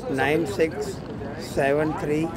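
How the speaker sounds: usual speaking voice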